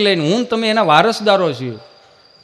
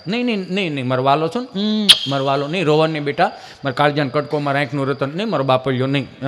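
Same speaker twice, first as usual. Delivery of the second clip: a hint of soft, lower cooing; soothing mother; 5 semitones lower, 5 dB quieter than usual. Mother gently hushing her crying son. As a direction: hush the crying child gently.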